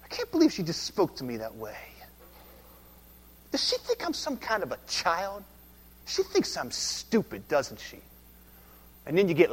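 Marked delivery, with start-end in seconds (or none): 0.0-8.0 resentful inner grumble. Retold or imitated. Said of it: "and you think".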